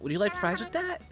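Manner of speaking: high fries line. Pretend. Recording the chosen greeting take. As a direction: ask it like a happy cashier. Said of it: "that works for you".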